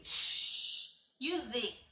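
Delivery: "x" as a long sh and "Z" as a buzzing zz, very slow; letter sounds. Shown as a demonstration. Teacher stretching the X and Z sounds for parents. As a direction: stretch each sound out slowly. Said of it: "o X".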